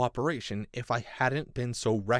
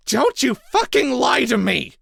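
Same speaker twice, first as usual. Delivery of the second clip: loud, high, furious shout; furious yell; 13 dB louder than usual. A narrator's reading. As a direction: scream it at him. Narrator yelling at captive.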